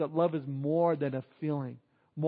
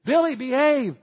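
loud, higher nagging yell; screaming parent; 6 semitones higher, 7 dB louder than usual. Retold or imitated. Parent screaming insistently at Billy, loud nagging. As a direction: shout it at the kid one more time. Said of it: "scream at their kids insistently".

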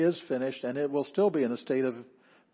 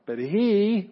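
he held long, much higher and louder; emphatic HE; 8 semitones higher, 8 dB louder than usual. Shown as a demonstration. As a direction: swell hard on the word he. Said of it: "that is emphasis".

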